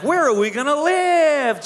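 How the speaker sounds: high, loud mock gushing question; overjoyed squeal. [name] to the owl after the proposal, mock thrilled.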